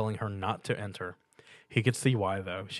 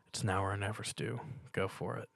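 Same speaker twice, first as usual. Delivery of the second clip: quiet inner voice; 9 dB quieter than usual. A narrator's reading. Inner self-encouragement.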